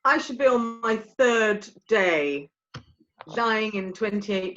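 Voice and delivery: ordinary talk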